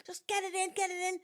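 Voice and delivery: high, hurried pleading; mock panic, rushing the dog inside; urgent son. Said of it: "he was going to me".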